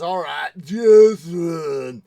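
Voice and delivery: very loud, drawn-out giving in; hammed-up glutton caving; Mister Creosote. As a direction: relent in a slow, booming voice. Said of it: "And he says".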